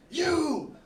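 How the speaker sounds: high shouted, slow broken-English landlady; mock the irate owner's accent, loud and slow; imitating an angry foreign homeowner. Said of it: "she's like".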